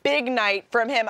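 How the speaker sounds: normal talking voice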